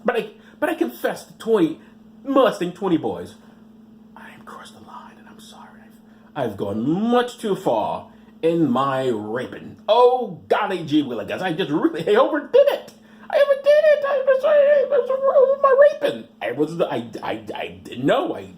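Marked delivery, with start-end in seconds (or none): 4.1-5.8 mock-contrite whisper; whispered confession. Mocking the priest's confession, whispered. 12.1-16.3 high, loud mock-sobbing wail; wailing confession. Mocking the priest confessing, high crying wail.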